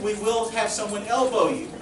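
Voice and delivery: ordinary talk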